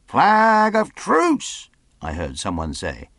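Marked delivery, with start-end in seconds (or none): 0.1-1.7 high, loud drawn-out shout; distant call. A narrator's reading. Someone hailing the stockade of a truce, drawn-out shout. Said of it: "the sound of voices".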